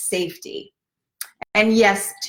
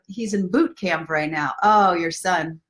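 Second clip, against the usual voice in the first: ordinary talk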